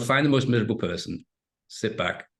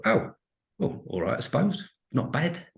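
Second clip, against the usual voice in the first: imitating a tired cashier, pitched up; mock-glum shrug of a reply; weary cashier; 8 semitones higher than usual. Imitating a weary shop worker.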